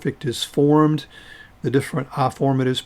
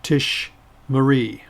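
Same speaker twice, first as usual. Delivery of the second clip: "Tish Marie" slow, falling at the end; pronounced for repetition; slower than usual. Shown as a demonstration. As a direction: model "Tish Marie" with a gap after Tish. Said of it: "pronounce them after me".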